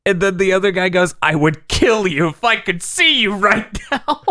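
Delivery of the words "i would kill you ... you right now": high, strained threat through a choke; mock fury from a burning throat; choking threat. Blinded fighter threatening the other, choking. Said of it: "You're choking too".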